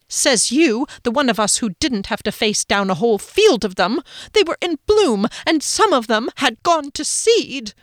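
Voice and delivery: high, loud petulant whine; indignant Phoenix. A narrator's reading. Phoenix snaps back at Rickenbacker in a high whine. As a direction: sulk back at him, offended.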